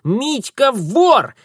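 slow, high, loud gloating; sing-song tattling child; triumphant taunt. Acted. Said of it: "торжествующее личико кузины".

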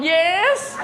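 câu "Yes" bật cao, hớn hở như người không hiểu gì; trả lời "Yes" cao giọng, vô tư; mock eager yes. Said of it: "Hỏi có đem hàng cấm không". (giả giọng ông thầy lơ mơ đáp "Yes" thật cao)

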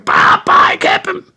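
loud, piercing call; shouted. A narrator's reading. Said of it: "Jaapie schreeuwde".

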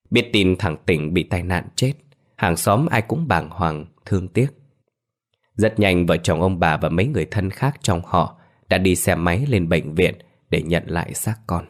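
plain reading voice

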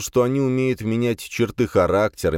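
usual speaking voice

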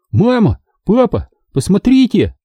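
high excited calls; calling out. A narrator's reading. Boy calls parents to see the comic, excited high calls. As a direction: shout for mom and dad to come look.

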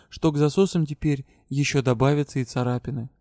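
ordinary delivery

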